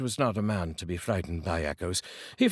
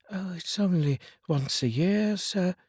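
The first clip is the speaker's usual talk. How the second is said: clerk's voice, pitched well higher; 9 semitones higher than usual. Acted. The clerk answering Scrooge, in a higher voice. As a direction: play the humble clerk, voice up high.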